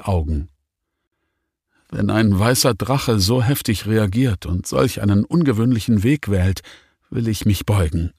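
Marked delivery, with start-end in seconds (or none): none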